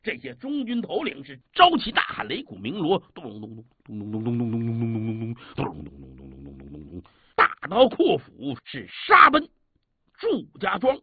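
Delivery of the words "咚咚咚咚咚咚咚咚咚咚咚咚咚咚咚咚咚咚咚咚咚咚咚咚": rapid low drumroll, booming dong dong; imitated war drums. Storyteller drumming for the charging army, fast and booming. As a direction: beat out the drums with the voice.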